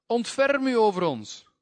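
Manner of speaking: high, loud desperate cry for mercy; pleading shout of the blind beggars. Acted. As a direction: call out across the road, begging.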